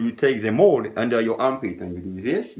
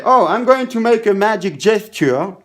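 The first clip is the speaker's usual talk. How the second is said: patter line; 7 semitones higher, 5 dB louder than usual. Shown as a demonstration. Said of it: "and I say".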